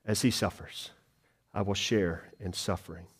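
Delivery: hushed, tender pulpit aside; soft, hushed sermon voice. Acted. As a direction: drop low and quiet, lean in. Quiet reflection on suffering.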